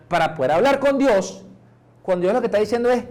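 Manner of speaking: ordinary talk, nothing marked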